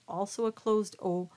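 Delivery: ordinary talk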